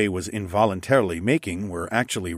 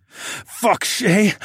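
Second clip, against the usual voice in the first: groaned; 13 semitones higher, 5 dB louder than usual. Acted. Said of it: "I groaned".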